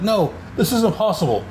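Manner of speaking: a hint of a desperate tyrant crying out; villain Torbull. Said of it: "Torbull's like".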